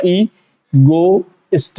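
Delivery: ईगोइस्ट in three slow chunks, ee, go, ist; split into parts. Shown as a demonstration. Teacher dividing the word for students, ईगोइस्ट slowly part by part. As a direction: stretch and separate each part of ईगोइस्ट. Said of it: "तीन इसको parts में हमने divide किया".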